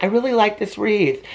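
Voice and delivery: high, wavering indecisive whine; Janelle's dithering. Retold or imitated. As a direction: mimic someone who can't decide about a wreath. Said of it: "And she's like".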